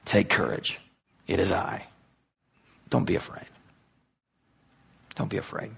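steady frightened friends gently; reassuring. Acted. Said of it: "But Jesus immediately said to them".

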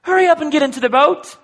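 high, loud whiny plea; mock Peter pleading. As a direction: beg him to hurry into the boat. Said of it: "Why not".

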